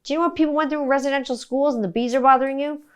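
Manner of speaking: retold rant at sister; indignant lecture. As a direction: scold the sister, quickly. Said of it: "I'm like".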